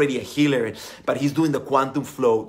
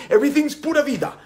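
higher, fast, breezy pura vida; mock carefree Costa Rican; 11 semitones higher, 5 dB louder, faster than usual. Coach mimicking Costa Ricans brushing off problems, higher and fast. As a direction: toss off pura vida like it fixes everything. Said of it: "In Costa Rica, we say".

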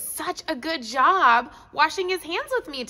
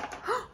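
gasp; 9 semitones higher, 11 dB quieter than usual. Pretend. Delighted gasp at clean paws.

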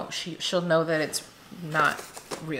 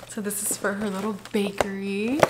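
ordinary delivery

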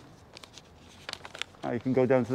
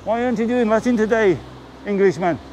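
stern teacher; 7 semitones higher, 5 dB louder than usual. Retold or imitated. Teacher scolding retold schoolboy.

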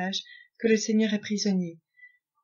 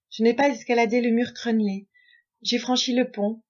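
ordinary delivery

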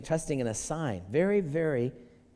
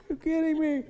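mock astonishment, pitched way up; shocked gasp; 11 semitones higher than usual. Preacher acts an old man hearing the baby news, shocked. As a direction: gasp then a much higher you're kidding me. Acting father told of baby.

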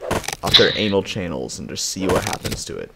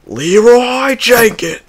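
slow, much higher, much louder drawn-out battle yell; battle cry; 13 dB louder, much slower than usual. Pretend. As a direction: charge in screaming the famous meme cry. Famous battle cry charge.

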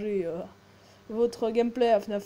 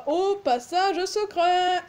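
ordinary talk, nothing marked

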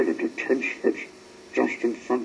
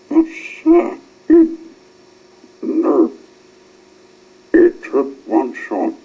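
pleading protest; 2 semitones higher, 10 dB louder than usual. A narrator's reading. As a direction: protest to the captain.

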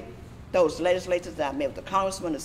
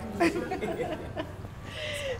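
a long laugh, much higher; real laugh; 8 dB quieter than usual. Real, not acted. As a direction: let a long high laugh roll out. Laughs about being scared stiff.

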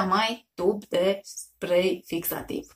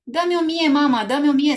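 higher and louder, eager; fired-up cheer; 6 semitones higher, 6 dB louder than usual. A narrator's reading. Jojo eagerly cheers his mom on, higher and louder. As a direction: egg mom on excitedly. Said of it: "se înflăcără Jojo".

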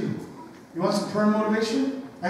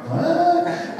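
puzzled youngsters; 11 semitones higher than usual. Retold or imitated. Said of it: "All the youngers are going".

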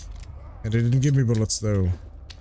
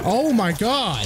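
startled shout; 10 semitones higher, much slower than usual. Real, not acted. Zombie suddenly attacks player.

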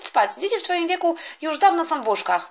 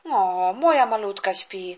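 tender mother; 8 semitones lower, slower than usual. Acted. Mother over sleeping baby.

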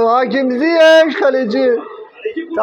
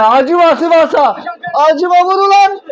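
urgent shout; 6 semitones higher, 4 dB louder than usual. Real, not acted. Urging player to shoot.

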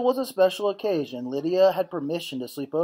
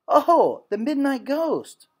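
high, louder, slow frightened cry; 10 semitones higher, 5 dB louder, much slower than usual. Acted. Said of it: "So I cried out to Papa".

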